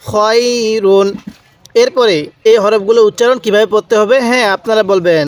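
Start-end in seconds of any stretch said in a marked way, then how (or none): 0.1-1.2 খয়রুন with the "khoy" glide held long; drawn-out recitation. Shown as a demonstration. Teacher modelling the word, খয়রুন slow and drawn out. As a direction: stretch খয় slowly, then close on রুন. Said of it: "এরকমভাবে শিখব".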